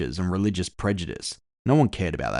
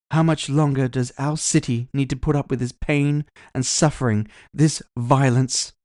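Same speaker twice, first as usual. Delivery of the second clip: she-elf politician rousing a crowd, higher pitch; rally speech; 6 semitones higher than usual. Acted. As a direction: orate to stir the crowd, drawing out the last words.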